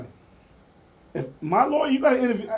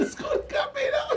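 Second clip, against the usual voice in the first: high squealing laugh; 5 dB quieter than usual. Real, not acted. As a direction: laugh shooting up high into the words. Cracks up at the story.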